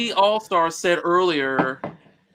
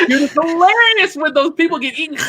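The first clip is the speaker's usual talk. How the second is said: laughing; 11 semitones higher, 6 dB louder than usual. Real, not acted. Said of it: "I don't think he understands comedy".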